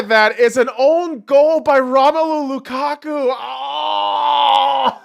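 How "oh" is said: shocked gasp. Real, not acted. Reacting to own goal.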